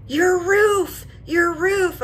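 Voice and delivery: high, loud call of a passing driver; stranger's warning yell. Retold or imitated. Driver calling out of his window about her roof, high and loud. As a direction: shout it across to warn her. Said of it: "And he goes".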